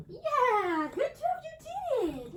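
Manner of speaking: high, loud, gushing praise; excited praise. Real, not acted. Trainer thrilled as the skittish dog succeeds, high excited praise. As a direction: celebrate the dog's win out loud.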